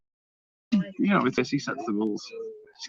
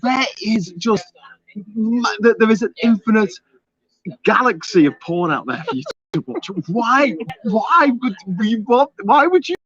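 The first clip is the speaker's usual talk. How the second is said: incredulous, higher-pitched protest; exasperated rant; 6 semitones higher than usual. Real, not acted. Host scolding viewers paying for a nude dare, exasperated. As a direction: plead with them in rising disbelief. Mock outrage at viewers wanting nudity.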